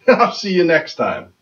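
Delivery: ordinary delivery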